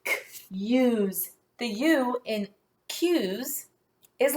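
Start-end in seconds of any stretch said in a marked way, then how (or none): 0.0-1.3 "K-, use" with K detached from long-U use; "K-, use" segmented to show the long U; sounding out. Shown as a demonstration. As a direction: isolate the K sound, pause, then "use".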